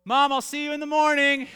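put-on high, loud little-girl call; toddler voice. Daughter calling past dad to mom, toddler squeal. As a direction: pipe it up like a small child.

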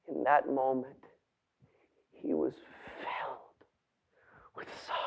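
very soft, low, halting storytelling; hushed, sorrowful narration with long pauses; solemn hush. A narrator's reading. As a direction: let each phrase sink almost to a whisper.

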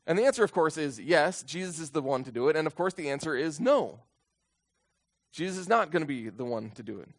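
ordinary delivery

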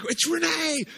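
high, loud happy shout; excited kid. Retold or imitated. Little boy spotting Renee, shouting with high glee.

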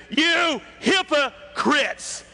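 high, drawn-out shout of accusation; shouted rebuke. Acted. Jesus answering the indignant synagogue ruler, shouted rebuke. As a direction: cry it out slowly as a rebuke. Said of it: "he just simply shouts out".

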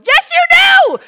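very high, very loud commands; top-of-the-lungs exorcism command; furious shout. Retold or imitated. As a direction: bellow it at the demon. Shouting at the demon.

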